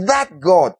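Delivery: ordinary talk, nothing marked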